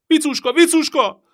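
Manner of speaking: very high, loud screaming of her name; desperate yelling. A narrator's reading. Captured boy screaming for his sister. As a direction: call for help in panic. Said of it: "Gergő tovább kiabált".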